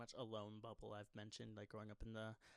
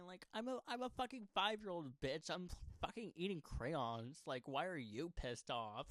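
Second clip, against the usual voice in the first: mocking inner voice; 5 semitones higher, 6 dB louder than usual. Mocking imagined family voice.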